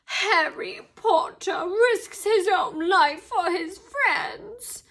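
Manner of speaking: high, slow elf moan; miserable ecstasy. A narrator's reading. Dobby awed by Harry's loyalty, moaning in miserable ecstasy. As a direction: moan it in anguished adoration. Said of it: "moaned Dobby in a kind of miserable ecstasy".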